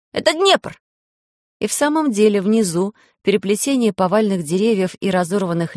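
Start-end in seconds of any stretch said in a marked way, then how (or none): 0.1-0.8 put-on high boyish cry of discovery; thrilled spotting call; excited exclamation. A narrator's reading. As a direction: blurt it out high and eager. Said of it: "воскликнул Пашка".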